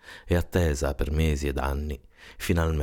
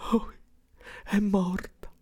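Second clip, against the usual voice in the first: high tearful keening building to a very high wail; convulsive weeping. A narrator's reading. Mother believes her little girl is dead, convulsive high-pitched wailing. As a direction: start breathy and pleading, climb into frantic, drawn-out grief. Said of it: "si mise a piangere spasmodicamente".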